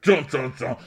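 loud high sung dun dun; mock epic music. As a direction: belt the epic theme sting. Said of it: "It's like Game of Thrones music".

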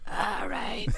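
husky smoker's voice; cigarette voice. Pretend. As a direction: rasp it like a heavy smoker. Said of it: "you have to have, like, a cigarette voice".

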